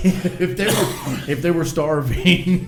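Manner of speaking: laughing through the words, slowed; giggling as he tells it. Real, not acted. Friend laughing while mocking Indiana folks, laughing.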